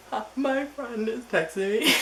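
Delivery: high, loud imitation of wailing; hammed-up tearful voice; mock bawling. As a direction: squeal it like you're pretending to bawl. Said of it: "and I'm, like, bawling".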